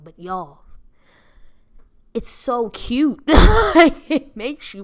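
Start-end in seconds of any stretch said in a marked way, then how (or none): 2.1-4.8 slow, loud gush over cute; delighted drawn-out cute. Real, not acted. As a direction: swoon over it. Gushing over water bottle.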